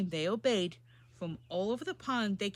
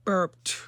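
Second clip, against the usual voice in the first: burp; 7 dB louder than usual. Acted. Mac burps in the story.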